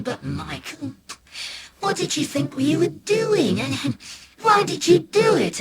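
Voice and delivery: high-pitched pleading mother; high, reproachful mum voice; dismayed mum. Acted. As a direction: question in pained bewilderment, pitch well up. Mum questions the boy in dismay.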